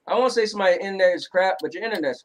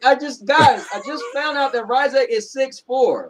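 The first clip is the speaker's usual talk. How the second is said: laughing; 8 semitones higher, slower than usual. Real, not acted. Laughing at news.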